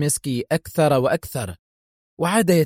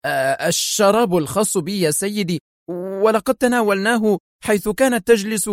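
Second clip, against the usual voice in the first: rattled, higher-pitched explanation; startled exclamation; 6 semitones higher than usual. A narrator's reading. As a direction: explain yourself nervously to the boss. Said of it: "انتفض جسد دوناهيو وهو يهتف".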